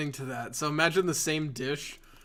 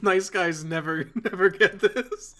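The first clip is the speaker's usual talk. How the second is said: laughing; 5 semitones higher, 6 dB louder, slower than usual. Real, not acted. Joking self-pity while laughing.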